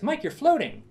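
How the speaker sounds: put-on high, loud German-accented cry. Natasha alerting her partner that he's floating, German accent. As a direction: call out sharply in a German accent. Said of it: "she's got a thick German accent".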